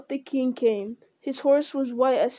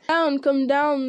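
loud, slow, higher taunting call; orc cry; 3 semitones higher, 5 dB louder, slower than usual. Acted. Orcs below the wall jeering at Aragorn, slow loud taunting cry. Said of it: "they cried".

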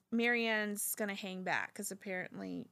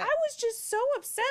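ordinary talk

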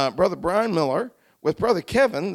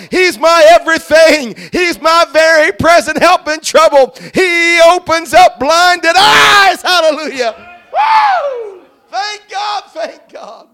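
fervent preaching; 6 semitones higher, 12 dB louder than usual. Real, not acted. Preacher testifying of restored sight, fervent and building to shouts. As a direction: build from pleading testimony to full-force shouted praise. Preaches his healing to the congregation.

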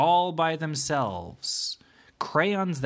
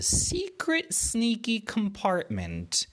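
high, slow, secretive storyteller voice; sneaky; 10 semitones higher, slower than usual. A narrator's reading. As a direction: draw it out all sly and mysterious. Story reveals secret compartment.

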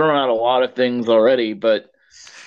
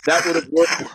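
matter-of-fact, understated quote; deadpan quote. Retold or imitated. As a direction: imitate a deadpan movie line. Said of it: "Just the deadpan way he says".

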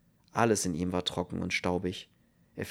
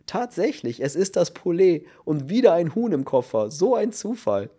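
much higher and louder, choking on hysterical laughter; stammering through laughter; 9 semitones higher, 7 dB louder than usual. A narrator's reading. Kern laughing helplessly at the man with the chicken. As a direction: barely get the words out between fits of laughing. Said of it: "stammelte er".

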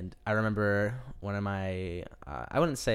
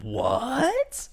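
much higher, incredulous cry; mock shocked crowd. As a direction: squeak it in disbelief. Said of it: "people like".

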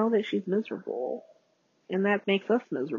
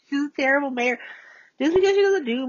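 laughing; 8 semitones higher, faster than usual. Real, not acted. Laughing at her own verdict.

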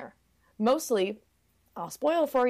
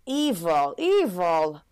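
put-on frog voice denouncing them; mock-horrified croaky verdict; condemning frog; 4 dB louder, much slower than usual. Frog condemns the two lovers, mock-horrified voice. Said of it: "this frog is like".

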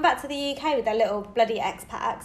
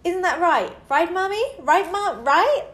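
lightly higher, pleading "right?" over and over; needy kid; 4 semitones higher, slower than usual. Retold or imitated. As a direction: nag like a four-year-old diva. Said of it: "Mason's like".

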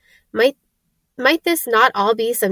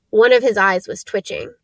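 plain reading voice

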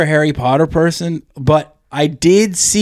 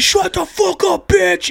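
angry yell from the back; slower than usual. Retold or imitated. Annoyed moviegoer yelling at a kid, furious. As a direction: bark it across the dark theater. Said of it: "this guy just yells it from the back".

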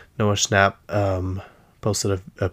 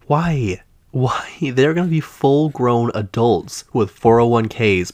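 indignant outburst, louder on the key words; exasperated; 3 semitones higher, 5 dB louder, slower than usual. Real, not acted. Host fed up with waiting for the show, exasperated. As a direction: protest it in real disbelief, voice lifting. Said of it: "so now I'm mad".

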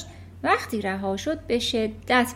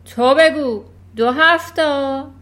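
loud, higher demanding shout, slow on the sum; 4 semitones higher, 11 dB louder, slower than usual. Acted. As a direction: bark the question at the boy. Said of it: "فریاد کشید". (فریاد بلند و آمرانه)

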